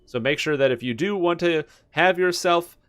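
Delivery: upbeat TV weather forecast; weatherman voice. Pretend. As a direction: present it like a local weather anchor. Continues pretend weather segment.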